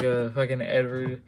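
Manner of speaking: ordinary delivery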